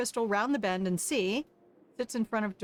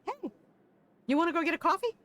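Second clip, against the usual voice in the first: put-on higher, bright girlish friend; lightly voiced cheerful girl; 8 semitones higher, much faster than usual. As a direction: invite them along sweetly. Crystal's cheerful offer.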